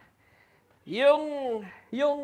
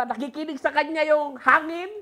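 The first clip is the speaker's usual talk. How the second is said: ordinary talk, nothing marked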